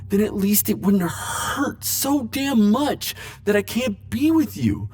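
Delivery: aching. Acted. Aching confession of love.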